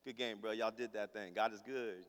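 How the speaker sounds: low, soft, casual greeting; muted bro-to-bro praise; playing it chill. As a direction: keep it cool and understated. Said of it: "Or I can be chill".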